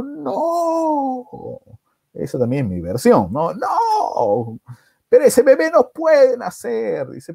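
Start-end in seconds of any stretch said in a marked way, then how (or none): 0.0-1.3 long, high drawn-out no; horrified refusal. Acted. Brother refusing the sister's plea, horrified. 3.5-7.1 high, drawn-out protest; acted outrage pitched way up; horrified refusal. Brother telling sister the baby cannot be born, horrified.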